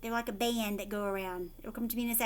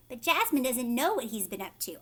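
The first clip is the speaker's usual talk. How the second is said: ordinary delivery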